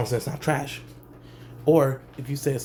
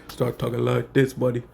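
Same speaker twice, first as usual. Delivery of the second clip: deeper voice. Shown as a demonstration. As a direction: voice dropped a little deeper, country-rap feel. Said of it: "make it deeper".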